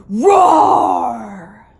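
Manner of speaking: slow, loud, roaring delivery; little Rex roaring at hungry eyes, slow and loud; fearsome roar. A narrator's reading. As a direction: stretch it into a huge roar. Narrator acts out roar.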